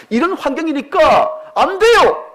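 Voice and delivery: loud, high, slow protest; complaining cry. Retold or imitated. Believer protests to God about circumstances, loud high complaint. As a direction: wail the excuse at God. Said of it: "이렇게 말합니다".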